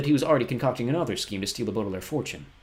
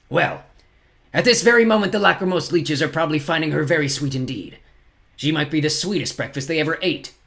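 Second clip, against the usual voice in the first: gloating villain, smiling cruelly; horrible smile; 6 semitones higher, 6 dB louder than usual. A narrator's reading. Olaf taunts orphans about Josephine, with a horrible smile. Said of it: "with a horrible smile".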